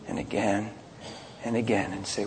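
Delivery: ordinary talk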